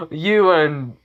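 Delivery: put-on high drunken laugh into loud words; drunk man. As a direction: laugh tipsily, then blurt it out. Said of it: "still drunk".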